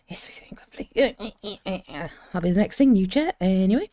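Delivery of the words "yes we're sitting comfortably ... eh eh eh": unvoiced, playful whisper. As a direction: whisper like telling children a secret. Mock storytime whisper.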